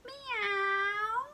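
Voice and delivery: high, long drawn meow; cat meow. Acted. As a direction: meow like a kitty. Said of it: "The cat says".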